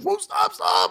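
high, strained cry of stop; begging him to let go, winded; pained plea. Retold or imitated. Retelling getting crushed by a friend, high pained plea. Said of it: "And I told".

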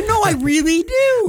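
high, loud, insistent fan voice; a fan insists he really listens to the show, squeaky and loud; pleading fan. Pretend.

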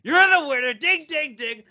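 high, excited winner's whoop; fired up. Real, not acted. Bettor telling friends his golfer won him money, fired up. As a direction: celebrate like a game-show host announcing a win. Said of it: "I'm fucking fired up, baby".

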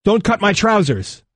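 high-pitched, restrained narrator's yell. A narrator's reading. Injured man yells at rescuers pulling his clothes, restrained yell. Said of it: "He yells at them".

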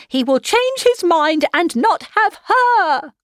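high, loud, flustered wailing mother; frantic calling out. A narrator's reading. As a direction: burst in with urgent panic. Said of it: "called out as she entered".

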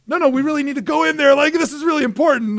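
pleading in a high strained voice. Retold or imitated. To the door lady, pleading in a high voice. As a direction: beg the door lady to let them in.

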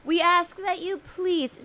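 high, slow, mock-pious plea; drawn-out, high hammed-up entreaty; mock pleading. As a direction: beg listeners with exaggerated solemnity. Mock-pious plea for Patreon sign-ups.

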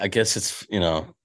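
usual speaking voice

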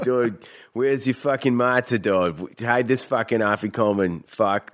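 put-on thick Masshole accent among buddies; Boston accent. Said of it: "kinda doing our fucking mass hole accents".

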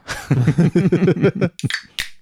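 high, bright laugh; burst of laughter. Real, not acted. Laugh while passing drinks.

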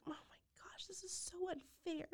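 hushed, high whisper of despair; whispered inner thought. Retold or imitated. Mother seeing her laughing kids, whispered despair. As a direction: breathe it out like a private thought. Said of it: "and just thinking".

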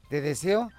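low, soft deadpan question; doubtful husband. Acted. Husband turning to his boastful wife, low doubtful question. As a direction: answer low and unimpressed, as the husband.

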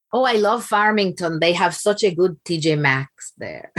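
slow, savoring Dominican mom voice; imitating Dominican mother. Mother praising Farmington's shopping, slow Dominican accent. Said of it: "She would say".

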